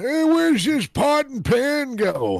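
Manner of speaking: high, drawn-out mock nagging voice; pestering coworker impression. Kitchen coworker interrupting a podcast, mocking pestering voice.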